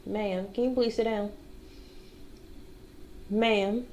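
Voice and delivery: hushed, gentle coaxing; soft pleading to dog. Real, not acted. Settling the dog down, hushed coaxing. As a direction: quietly beg the dog to sit.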